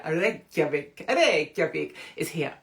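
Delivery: drawn-out, badly faked Icelandic accent; poor Icelandic accent. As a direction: put on a slow, poor Icelandic accent for Reykjavik. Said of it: "I don't know why the accent, it was poor".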